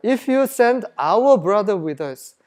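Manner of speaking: slow, measured Bible reading; scripture reading. Acted. Preacher reading the verse to the congregation, slow and measured. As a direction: read each English word out slowly. Reads scripture aloud slowly.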